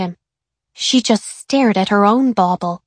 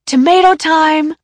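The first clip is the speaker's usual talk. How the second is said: put-on higher, louder gleeful boy voice; excited shout; 8 semitones higher, 6 dB louder than usual. A narrator's reading. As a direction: announce it like a treat. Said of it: "shouted Kevin".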